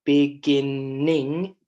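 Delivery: "Beginning" very slow, loud, in parts: be-GIN-ning; stressed syllable. Shown as a demonstration. Teacher shows class the doubled N, syllable by syllable. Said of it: "it's stressed a little bit more".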